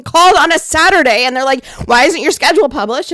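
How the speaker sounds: loud, worked-up complaint; heated yelling. Real, not acted. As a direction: vent at full volume about a work call. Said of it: "was it 'cause I was yelling?".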